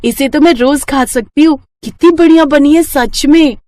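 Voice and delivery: put-on high child voice, full of enthusiasm; an excited girl praising her mother's cooking; delighted little daughter. Daughter keeps praising the dish. (उत्साहित बच्ची की तारीफ़)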